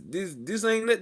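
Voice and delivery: normal talking voice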